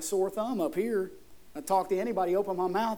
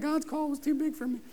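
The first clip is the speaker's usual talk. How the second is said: mimicked congregant; 5 semitones higher, 5 dB quieter than usual. Mimics those who raised hands.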